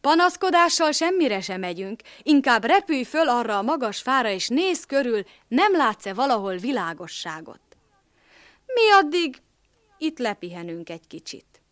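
scolding. A narrator's reading. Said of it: "Füles letorkolta".

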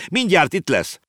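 high-pitched urgent warning; boy explaining. Acted. Boy warning the horse about the whale, urgent. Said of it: "magyarázta Konrád".

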